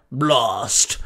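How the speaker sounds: low, drawn-out creature cry; Blastoise calling out. Acted. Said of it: "Blastoise called out".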